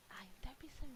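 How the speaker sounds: unvoiced stage whisper; hushed whispered rebuke. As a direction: whisper it across the table. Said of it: "girl whispers".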